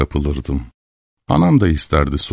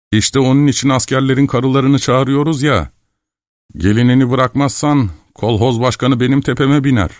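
higher, insistent character voice; exasperated shouting team leader; 7 semitones higher than usual. A narrator's reading. Lame team leader arguing with the mother, exasperated. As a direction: argue back, pitched up and pleading your case. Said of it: "diye bağırdı".

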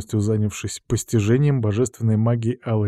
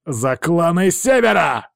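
barbarian battle cry; 7 semitones higher, slower than usual. A narrator's reading. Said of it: "прогремел голос варвара".

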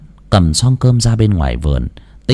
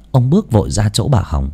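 usual speaking voice

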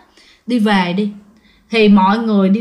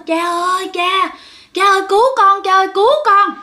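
retold terrified scream; 7 semitones higher, faster than usual. Possessed girl screaming to her father for help, high panicked cry. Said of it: "cổ gào, cổ nói".

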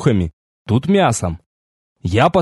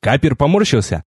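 ordinary delivery, nothing marked

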